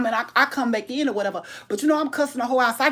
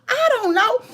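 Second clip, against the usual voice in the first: high, squeaky, hammed-up cluelessness; mock innocence; 12 semitones higher, 3 dB louder than usual. Retelling her answer to her son, mock innocent. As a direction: play dumb in a high voice.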